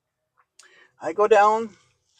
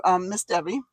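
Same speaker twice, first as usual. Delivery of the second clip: usual speaking voice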